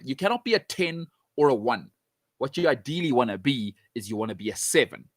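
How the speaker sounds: ordinary talk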